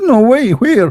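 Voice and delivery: high, startled "No way, where?"; panicked disbelief. Retold or imitated. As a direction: jump up in shock. Friend panics.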